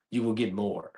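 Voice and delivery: ordinary talk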